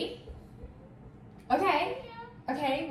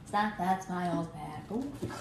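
slow repeat; 5 semitones lower, 5 dB quieter, slower than usual. Pretend. Echoes slogan slowly.

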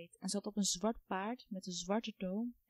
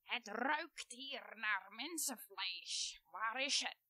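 witch sniffing for flesh; 6 dB quieter, much slower than usual. A narrator's reading. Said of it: "Ze schreeuwde".

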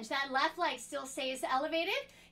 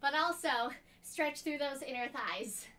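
ordinary delivery

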